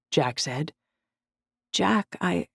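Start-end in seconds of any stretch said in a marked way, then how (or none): 1.7-2.5 halting, higher, caught-off-guard; stumbling. A narrator's reading. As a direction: stumble over the words. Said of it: "I stumbled through the words".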